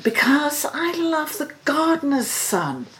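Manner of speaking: put-on high young princess confessing; a daughter owning up to her love. A narrator's reading. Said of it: "answered the princess".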